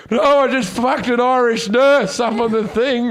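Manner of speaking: high, put-on voice; lightly hammed drunk voice; mock drunk protest. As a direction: play up the drunk denial. Retold own drunk protest.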